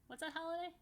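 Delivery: ordinary delivery, nothing marked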